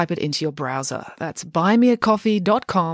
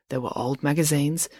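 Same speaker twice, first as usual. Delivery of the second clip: plain reading voice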